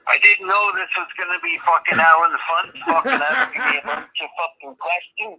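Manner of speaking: broad mock Scottish brogue throughout; put-on Scottish accent. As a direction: play a hammed-up Scotsman, aye. Fake Scot on a prank call.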